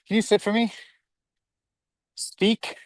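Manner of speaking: high, sing-song dog voice; dog command voice. Real, not acted.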